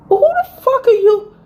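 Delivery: high, loud, indignant; who does he think he is; outraged outburst. Retold or imitated. To her boyfriend after his insults, outraged and loud.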